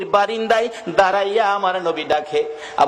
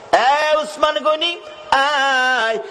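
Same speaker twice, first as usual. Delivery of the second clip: high, drawn-out summons; calling out; 9 semitones higher, much slower than usual. Acted. The Prophet calling Usman over, calling out. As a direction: stretch the name into a melodic call.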